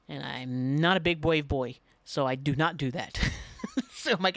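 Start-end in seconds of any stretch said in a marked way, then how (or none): 3.2-3.9 high, quick little laugh; amused giggle. Real, not acted. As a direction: giggle at your own cowardice. Laughs at backing out.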